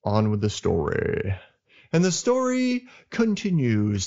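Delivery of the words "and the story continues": higher, slow, hammed-up announcer; a playful put-on announcer voice; mock announcer voice. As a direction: announce it like a radio host returning from a break. Mock announcer resumes the show.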